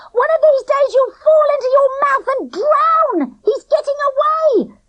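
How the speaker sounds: high, loud taunting yell; angry shout. A narrator's reading. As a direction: yell the insult after him. Girl shouting after fleeing boy.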